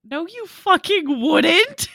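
mock scorn. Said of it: "that look from Karen of being like".

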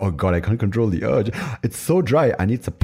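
high, loud, gasping mock desperation; hammed-up loss of self-control; mock desperate urge. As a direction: gasp and plead, overacting. Acting out someone overcome by urge.

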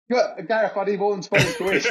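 high laughing mock plea for cash; laughing as he begs a friend for money.

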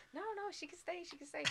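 high, squeaky puppet voice; high, soft Muppet plea. Retold or imitated. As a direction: chirp it like a Muppet. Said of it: "the Muppets were all going".

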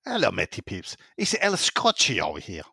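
light mock Scottish accent; put-on accent. Host reads a listener message in a light Scottish accent. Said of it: "this is do the accent".

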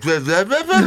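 very high, loud gibberish breaking into laughter; failed mimicry babble. Shown as a demonstration. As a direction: attempt the impression as squeaky nonsense, laughing. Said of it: "do it again. I'll be like".

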